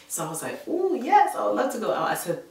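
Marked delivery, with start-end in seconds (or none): 0.7-2.1 high, excited squeal of a yes; eager acceptance. Retold or imitated. Accepting her date's invitation to go dancing, excited. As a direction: jump at the offer, pitched way up.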